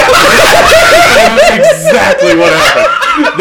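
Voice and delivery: high, loud, slow laughing yell; laughing shout. Real, not acted.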